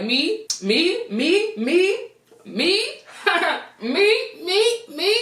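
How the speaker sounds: high, eager repeated me; eager volunteering. Retold or imitated. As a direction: raise your hand and beg to go.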